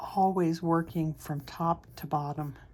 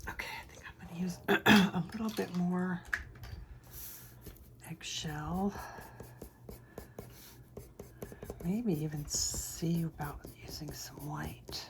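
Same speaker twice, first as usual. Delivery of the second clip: soft unvoiced ASMR whisper; 6 dB quieter than usual. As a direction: murmur it close and hushed. Whispered drawing tutorial.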